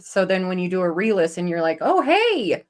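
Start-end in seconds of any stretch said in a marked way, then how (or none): 1.8-2.6 high, swooping realization; pleasant surprise. Retold or imitated. Listener catching a missed bit on re-listen, pleasantly surprised. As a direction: act out suddenly noticing something. Acts out noticing something on re-listen.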